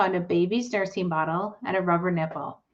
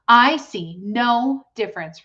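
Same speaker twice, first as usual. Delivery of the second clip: put-on stubborn girl; 4 semitones higher, 7 dB louder than usual. A narrator's reading. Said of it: "replied Fern".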